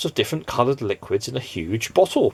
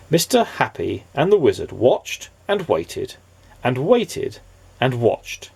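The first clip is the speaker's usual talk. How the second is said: ordinary delivery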